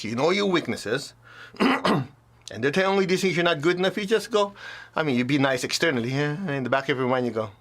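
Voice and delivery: ordinary talk, nothing marked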